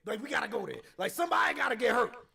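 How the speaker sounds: worked-up confrontational rant; heated warning. Retold or imitated. Heated warning about street confrontation.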